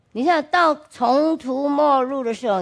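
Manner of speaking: ordinary talk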